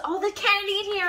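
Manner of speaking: thrilled to find candy; excited discovery. Real, not acted. Finding candy in the parcel, a loud delighted squeal. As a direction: voice jumps high and loud at the candy.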